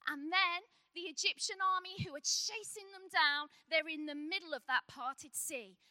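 ordinary talk

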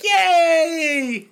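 high, loud drawn-out celebration; mock cheer. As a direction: cheer like a delighted award winner. Acting out a happy award winner.

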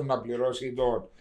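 ordinary talk

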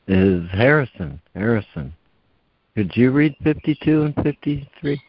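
usual speaking voice

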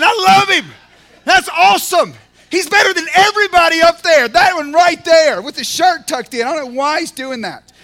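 loud, high shouts of a proud father; proud cheering dad. Acted. God as the front-row dad, shouting proud of his six-year-old.

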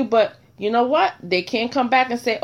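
ordinary talk, nothing marked

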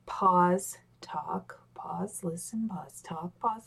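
slow, fading to a hush; slow rhythmic demo. Shown as a demonstration. Coach showing the talk-listen rhythm, slow and fading. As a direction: spell out the rhythm word by word. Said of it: "just to give that".

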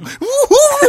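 high, loud whoop of glee; hammed-up "woo-hoo" while dashing off; squealing cheer; gleeful whoop. Acting out gleeful run to tell.

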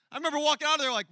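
usual speaking voice

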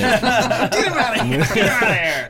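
laughing. Pretend. Laughing joke at the dog.

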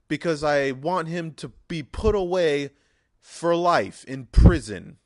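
loud, high, slow indignant complaint to police; mock outraged caller. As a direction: demand justice for the dog. Mock caller reporting dog killer.

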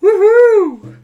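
loud high woohoo; cheering. Real, not acted. Greeting a favourite reviewer's return, a loud high cheer. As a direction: cheer at the top of your voice.

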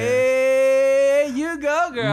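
loud, high whoop rising out of a long held "hey"; mock cheering. Girls at the table egging on their friend, mock cheering. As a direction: cheer her on like a rowdy girlfriend. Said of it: "All her girls are cheering her on".

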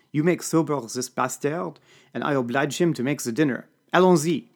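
rally the troops in broken French English; commanding French accent. A narrator's reading. Serge orders the narrator to sober the chef, French accent. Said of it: "Serge gives orders".

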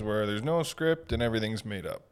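hammy game-show host; announcer voice. Pretend. Mock game-show host intro.

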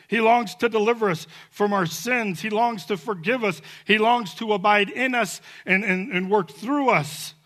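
fervent sermon delivery; preaching. Acted. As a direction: proclaim it from the pulpit. Preaching at full force.

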